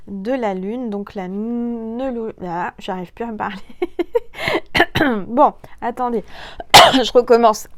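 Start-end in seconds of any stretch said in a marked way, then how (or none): 3.7-5.2 loud, high coughing fit; real coughing. Real, not acted. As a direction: cough hard to clear the throat. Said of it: "je tousse dans le micro".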